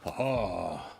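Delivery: ordinary delivery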